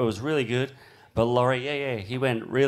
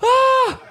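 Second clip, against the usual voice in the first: very high, loud panicked ah; a shriek of alarm at a backflip wipeout; shrieked gasp of alarm; 8 dB louder than usual. Retold or imitated. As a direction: yelp in fright. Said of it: "And we're like".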